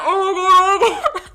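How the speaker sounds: loud, high panicked oh my God with a laugh; panicked exclaiming. Retold or imitated. Retelling panic when he spoke English, loud laughing shriek.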